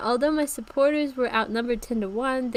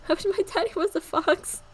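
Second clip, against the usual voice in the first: put-on higher childlike wish; little girl voice; 8 semitones higher than usual. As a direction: say it like a wistful small girl. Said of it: "was her reply".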